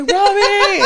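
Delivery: high, loud mock call. Imitating dad calling Robbie to the room, high mock holler. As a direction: holler the name, imitating a summons.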